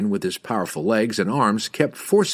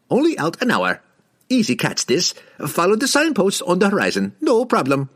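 higher, lively market trader; merchant voice; 10 semitones higher, 3 dB louder than usual. A narrator's reading. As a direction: hawk it like a desert merchant. Merchant's voice in the story.

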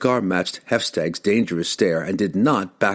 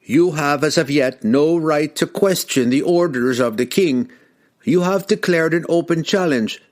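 raised, higher, firm ruling; firm elder; 7 semitones higher than usual. Acted. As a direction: lay down the law firmly. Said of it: "Revjak said firmly".

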